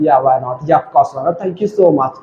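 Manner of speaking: cheerful, polite yes; happy friendly tone. Shown as a demonstration. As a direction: say it gladly, like doing a favour. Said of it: "जब खुश होके बोलते हैं".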